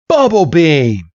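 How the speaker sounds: acted battle command; attack call. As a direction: call the move out to your monster. Trainer calls out an attack.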